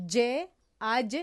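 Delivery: slow, loud demo of the sound; letter demo. Shown as a demonstration. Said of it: "از مابین زبان حرف". (تلفظ آهسته و واضح حرف)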